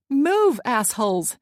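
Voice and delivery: angry yell at traffic. A narrator's reading. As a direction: yell it out in road rage. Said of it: "she yelled".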